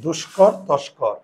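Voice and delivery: slow, deliberate word pair; teacher's slow contrast of দুষ্কর, তস্কর; slow dictation. Shown as a demonstration. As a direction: say দুষ্কর and তস্কর slowly, stressing দুষ্কর. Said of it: "খেয়াল করো".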